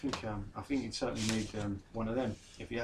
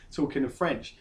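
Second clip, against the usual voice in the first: ordinary talk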